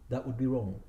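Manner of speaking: usual speaking voice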